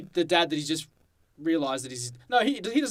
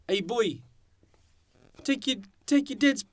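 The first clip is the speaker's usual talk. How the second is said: bad New Zealand accent; 8 semitones higher than usual. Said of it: "So bad".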